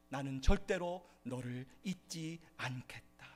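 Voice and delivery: whispered promise. Acted. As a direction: whisper the promise slowly and tenderly. God's words in Isaiah quote. (천천히 속삭이는 하나님의 약속)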